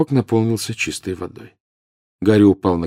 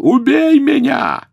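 high, slow desperate scream; agonised scream; 6 dB louder, slower than usual. A narrator's reading. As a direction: scream it in torment. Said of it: "завопил". (отчаянный вопль)

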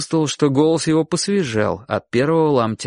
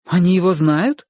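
put-on high boy's question, rising in wonder; surprised; 6 semitones higher, 3 dB louder than usual. A narrator's reading. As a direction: ask in mild astonishment. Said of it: "удивлённо спросил Чик". (удивлённо, по-детски)

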